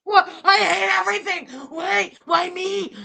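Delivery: high, whiny mock tantrum; baby bitch mode. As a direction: cry out in hammed-up self-pity. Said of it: "that's my baby bitch mode".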